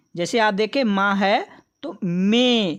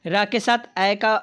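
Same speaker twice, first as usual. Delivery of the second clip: ordinary talk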